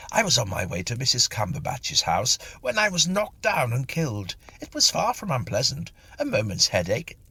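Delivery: lightly voiced gentleman, a touch brighter; a hint of an eager, chatty gentleman greeting a lady. A narrator's reading. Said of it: "he said".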